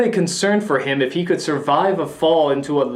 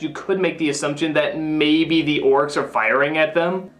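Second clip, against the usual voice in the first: ordinary talk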